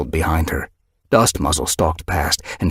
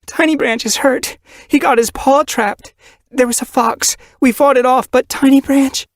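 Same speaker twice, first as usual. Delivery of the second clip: high, loud, breathless panic; terrified she-cat reporting an injured kit; desperate report; 6 dB louder than usual. Acted. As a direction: blurt the news in a frantic rush.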